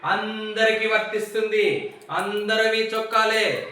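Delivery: slow, stretched-out words; emphatic drawn-out explaining. Real, not acted. Teacher drilling common nouns into the class, emphatic and drawn out. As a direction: drum the rule in slowly, stretching each word.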